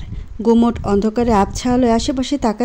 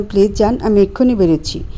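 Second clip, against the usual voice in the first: ordinary delivery, nothing marked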